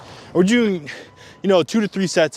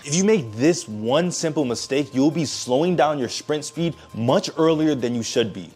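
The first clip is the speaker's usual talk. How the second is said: voiceover narration. Acted.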